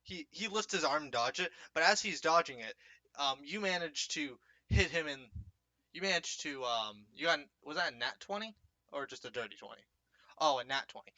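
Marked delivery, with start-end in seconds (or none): none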